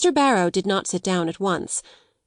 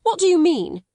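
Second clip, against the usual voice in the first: high, louder alarmed cry; 10 semitones higher, 4 dB louder than usual. Acted. Headmistress cries out at the solicitor, high and alarmed. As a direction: cry out in shock. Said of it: "she cried".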